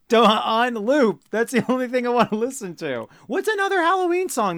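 squeaky mock-excited whine. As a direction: pitch it way up, stretched out. Hammed-up partygoer.

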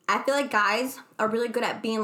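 usual speaking voice

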